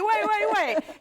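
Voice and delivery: high, loud, rapid-fire double take; excited disbelief. Retold or imitated. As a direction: stop everything in excited shock. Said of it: "I'm like".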